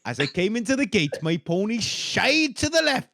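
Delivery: put-on comic Welsh accent reading a witness account; funny Welsh accent. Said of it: "read his account of finding the body in a funny accent".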